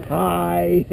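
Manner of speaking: ordinary delivery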